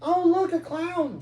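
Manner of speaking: put-on high excited squeal of a tiny girl; squealing little girl. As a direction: squeal with delight like a toddler. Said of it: "squealed the tiny girl".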